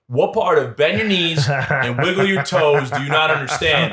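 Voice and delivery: slow, emphatic instructor's dressing-down; drill instructor. Retold or imitated. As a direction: imitate an instructor scolding a recruit, word by word. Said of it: "he goes".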